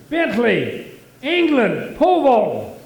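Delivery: loud, formal, high call at the gate; proud announcement. Acted. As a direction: announce yourself like an Olympian.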